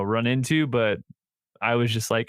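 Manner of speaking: ordinary talk, nothing marked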